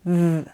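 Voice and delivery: buzzing voiced th, tongue between teeth. Shown as a demonstration. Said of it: "you'll feel it vibrate here".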